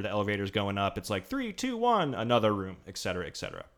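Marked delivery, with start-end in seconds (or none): 1.3-2.1 high, punchy countdown; game announcer. Retold or imitated. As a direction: call it like a game host.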